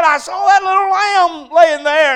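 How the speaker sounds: high, loud preacher's cry; preaching shout. Retold or imitated. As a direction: cry it out to the whole congregation. Preacher raising voice at story's key moment.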